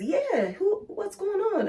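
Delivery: imitating old man. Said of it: "And he says".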